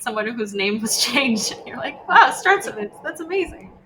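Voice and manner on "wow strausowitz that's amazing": high-pitched mock wonder; acted amazement. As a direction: play an amazed listener, pitch way up. Acted amazed reaction to a name.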